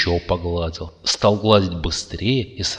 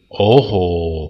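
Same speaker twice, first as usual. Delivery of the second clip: ordinary delivery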